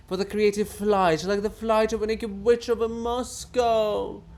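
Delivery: high, loud outraged lament; pompous writer mourning his Kiev; loud grieving tirade. Acted. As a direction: rage over the ruined city. Bulgakov laments lost Kiev.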